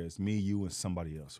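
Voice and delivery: ordinary talk, nothing marked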